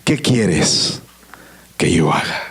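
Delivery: slow plea sinking very low; awed surrender, voice falling away; trembling submission. Acted. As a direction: pause between phrases, dropping to a low, humbled murmur. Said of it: "Y él temblando dice que le dijo".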